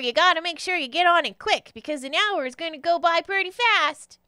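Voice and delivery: high, emphatic pixie voice; pixie giving warning. Acted. Pixie explains the potion to a newcomer, high and emphatic. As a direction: stretch key words in a high character voice.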